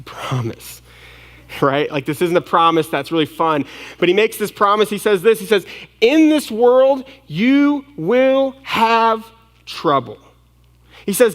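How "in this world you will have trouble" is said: "In this world, you will have trouble" slow and raised; "In this world" high and loud, then "you will have trouble" drawn out; emphatic pronouncement. Acted. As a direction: hammer out each word of "you will have trouble" slowly. Preacher drives home Jesus' unwelcome promise.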